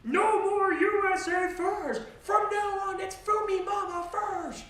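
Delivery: very high, loud, slow mock American accent; overblown American accent. Trump at congress speech in an overblown American accent. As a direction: belt it like a parody American president. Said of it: "he speaks in a completely overblown American accent".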